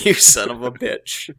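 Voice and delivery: loud joking curse; hammed-up curse. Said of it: "I have my sick voice".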